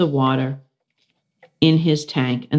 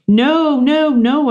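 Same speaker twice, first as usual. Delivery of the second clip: high, loud panicked squeak; 10 semitones higher, 5 dB louder, slower than usual. Acted. Hamster begging not to be sawn, high loud squeak. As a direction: squeal no in fright. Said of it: "I squeaked".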